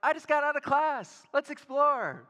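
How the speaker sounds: put-on young wife calling to explore; excited wife. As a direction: imitate wife bursting with excitement. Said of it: "Sydney was like".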